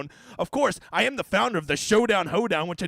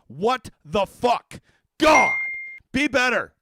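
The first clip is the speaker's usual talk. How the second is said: real angry shout; 2 semitones higher, 6 dB louder, much slower than usual. Real, not acted. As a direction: blow up at the site, dragging the words out. Ranting at DraftKings' full contests.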